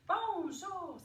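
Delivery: bonjour called out high and loud. Shown as a demonstration. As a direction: sing out bonjour to the kids. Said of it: "dis bonjour".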